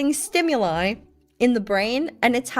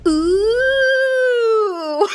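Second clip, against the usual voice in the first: long, high, gliding ooh; dopey awestruck ooh; 9 semitones higher, 4 dB louder than usual. Retold or imitated. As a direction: gawp aloud like a clueless civilian. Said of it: "I go like a stupid civilian".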